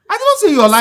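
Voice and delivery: high, loud protest; heated denial. Acted. Protesting to the angry neighbour, high and loud. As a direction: fire back the denial.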